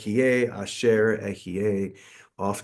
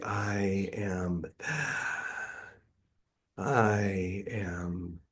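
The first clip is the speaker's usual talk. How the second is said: slow, soft words on the out-breath, sigh between; breathed-out mantra; 3 semitones lower, 10 dB quieter, much slower than usual. Shown as a demonstration. Teacher showing listeners the circular breath, words breathed out slowly. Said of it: "breathe out on the words".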